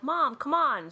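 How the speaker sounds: high pleading come on; a light imitation of a pleading teen; urging teen. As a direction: nudge mom impatiently. Teen urging her mother.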